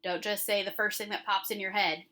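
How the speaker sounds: normal talking voice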